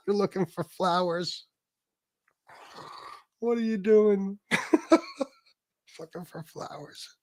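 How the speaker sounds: real giggles breaking up the words; laughing in disbelief. Real, not acted. Host ridiculing would-be fighter's flower trip, laughing helplessly. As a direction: crack up, voice thinning out into laughter.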